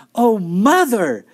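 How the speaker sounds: high, loud, drawn-out delight; enthusiastic cry. A narrator's reading. Daughter thrilled at mother's news, enthusiastic cry. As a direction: stretch it out, overjoyed for her. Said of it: "Beezus was all enthusiasm".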